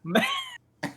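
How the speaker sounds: ordinary talk, nothing marked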